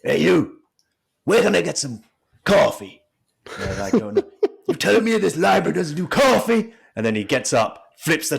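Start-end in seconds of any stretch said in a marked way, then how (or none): 0.0-3.0 loud, higher demanding call. Retold or imitated. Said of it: "he goes". 3.9-6.7 hammed-up outraged wrestler; Booker T impression. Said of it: "he goes".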